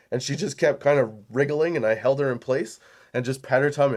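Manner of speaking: ordinary delivery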